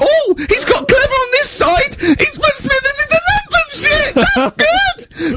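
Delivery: extremely high, slow, sobbing shriek of sarcasm; berserk teacher. Retold or imitated. Teacher sarcastically praising a rude drawing, sobbing shriek. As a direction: shriek it, half crying. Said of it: "he went berserk".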